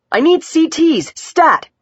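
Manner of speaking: high, urgent doctor's shout. A narrator's reading. Doctor ordering scans for trauma patient, shouting. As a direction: call out the order sharply, pitched well up. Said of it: "Doctor Bruno was shouting".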